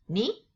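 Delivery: "ni" gliding upward; rising tone ni. Shown as a demonstration. Teacher showing the second Mandarin tone. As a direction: slide "ni" up like a question. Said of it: "the second going up tone".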